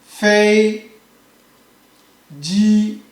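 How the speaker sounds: 一声"飞机"; first-tone fei ji. Shown as a demonstration. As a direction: hold each syllable of "Fei ji" flat. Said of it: "You can see the sound is".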